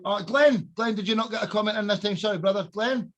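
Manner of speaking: ordinary delivery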